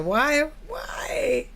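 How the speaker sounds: high exasperated squeal. Real, not acted. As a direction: squeal in disbelief.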